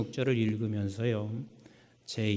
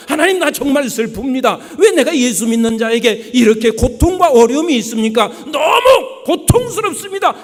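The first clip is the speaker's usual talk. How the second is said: anguished prayer shout; 14 semitones higher, 16 dB louder than usual. Acted. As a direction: wail the lament in full voice.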